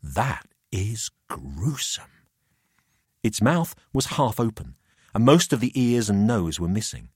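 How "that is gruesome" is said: slow hushed whisper. A narrator's reading. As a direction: hush it out in disgust. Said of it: "whispered Jamie".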